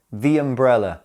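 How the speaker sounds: "the" said "thee" before "umbrella"; "thee" before vowel. Shown as a demonstration. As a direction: say "the" with ee, linking into "umbrella". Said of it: "notice the difference in pronunciation".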